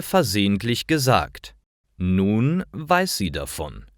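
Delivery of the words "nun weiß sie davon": normal talking voice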